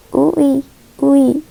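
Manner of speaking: "U I" said as two separate vowels, u then i; vowel demo. Shown as a demonstration. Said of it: "mas é meio que ui".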